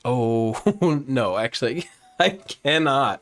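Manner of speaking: awkward reply broken up by laughter; laughing mock refusal. Asked to take off a wig hat, laughing refusal. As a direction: giggle through an acted excuse. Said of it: "And you're like".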